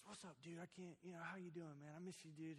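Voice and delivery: a hint of an upbeat buddy on the phone; mildly imitated happy greeting; happy brother.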